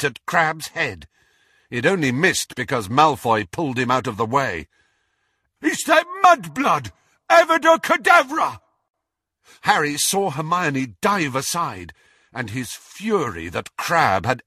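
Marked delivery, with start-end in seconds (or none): 5.6-8.6 very high, loud furious cry; shouted killing curse. A narrator's reading. Crabbe attacks Hermione with a curse, shouting. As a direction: scream out the spell.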